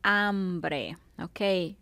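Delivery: ordinary talk, nothing marked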